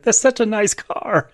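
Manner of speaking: high-pitched quoted line; imitating the character. Host quoting the woman blowing up the car, lightly imitated. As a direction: lightly voice her, pitched well up. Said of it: "She's like".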